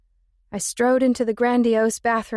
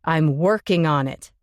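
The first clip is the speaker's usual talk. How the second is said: lower, louder angry retort; angry male voice; 5 semitones lower, 4 dB louder than usual. Acted. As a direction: snap back in anger. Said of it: "his voice full of anger".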